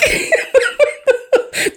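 high, airy giggling; burst of laughter. Real, not acted. Host tickled by pawning pricey earrings, high laugh. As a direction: crack up mid-rant. Amused by her earrings scenario.

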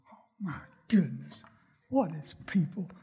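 unvoiced, breathless whisper of disbelief. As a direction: hiss it to yourself in disbelief. Whispered disbelief while driving.

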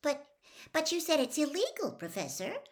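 uncertain. Acted. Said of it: "said Hermione uncertainly".

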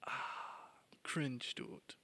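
hushed disgusted aside; whispered cringe. Retold or imitated. Thinking privately while watching teens, whispered cringe. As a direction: whisper "cringe, Dude" under the breath. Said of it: "Und du denkst dir so".

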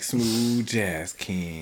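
slow, low, smooth crooning king; smooth jazz king. Pretend. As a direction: purr it like a jazz DJ. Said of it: "I'd be like".

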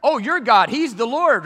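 high, loud calling out of the fire; excited calling out. Retold or imitated. King calling men from the furnace, loud high shout. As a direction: shout them out in amazement. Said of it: "his whole tune changed".

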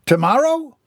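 high, aghast question; undone. A narrator's reading. Mortimer learns of the delay, undone. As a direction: shoot up in appalled disbelief. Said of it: "Mortimer was undone".

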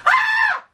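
very high shrieking mock scream; hammed-up shriek through a laugh. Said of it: "you would think she would at least be like".

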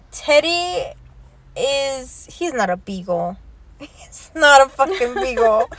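ordinary talk

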